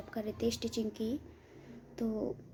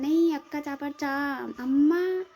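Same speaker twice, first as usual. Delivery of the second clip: higher, louder mock call of a little girl; imitating the girls calling; 4 semitones higher, 8 dB louder, slower than usual. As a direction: call out to mum like a child. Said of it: "वो लोग कहते हैं". (बच्चियों की नकल में ऊँची आवाज़ में पुकारना)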